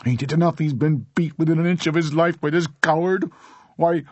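high, loud outraged mother; indignant outburst. A narrator's reading. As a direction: protest loudly, cut off mid-rant. Mother's shrill outburst continues.

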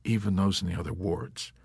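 soft, subdued answer; meek confirmation. Acted. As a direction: confirm it quietly, at an even pitch.

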